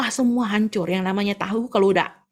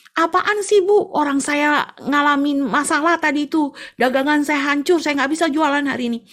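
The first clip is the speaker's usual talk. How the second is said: high, louder scolding; irritated complaint; 6 semitones higher, 5 dB louder than usual. Acted. Tofu seller scolding the neighbour, raised irritated voice. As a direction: scold her for banging so early. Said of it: "tukang tahu bilang ini".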